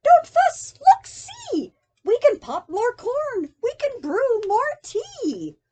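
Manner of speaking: high loud squeaky comfort; squeaky reassuring mouse. Acted. Mouse comforts crying bear, high squeak. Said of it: "Mouse squeaks".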